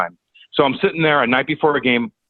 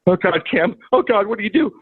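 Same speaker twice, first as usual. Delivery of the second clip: higher, rising panic; rising panic on the phone; panicked self-talk; 7 semitones higher than usual. Retold or imitated. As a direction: freak out, voice climbing higher. Panicking to himself.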